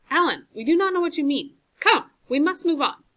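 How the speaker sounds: high, loud pressing plea; urgent pleading. A narrator's reading. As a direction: urge him to get moving.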